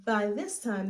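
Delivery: ordinary delivery, nothing marked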